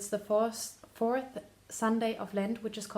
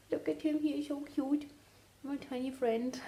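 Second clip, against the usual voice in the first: high, soft doting coo; cooing at a pet; 5 semitones higher, 4 dB quieter than usual. Real, not acted. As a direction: coo fondly over a tiny cute dog. Cooing over her dog.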